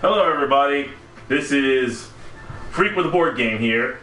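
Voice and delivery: ordinary talk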